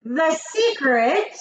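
slow, high, suspenseful reveal; grand witch proclamation, high and stretched; triumphant announcement. A narrator's reading. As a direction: draw it out triumphantly. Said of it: "announced the Grand High Witch triumphantly".

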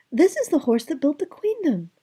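put-on high, bright young princess voice; cartoon princess. A narrator's reading. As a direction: play a sweet storybook princess. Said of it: "the princess said".

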